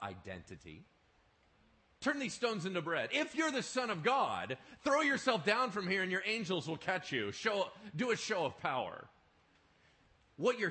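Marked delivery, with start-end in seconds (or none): 2.0-9.1 higher and louder, daring Satan; taunting devil. Acted. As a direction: goad him to prove himself. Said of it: "The devil comes up and goes".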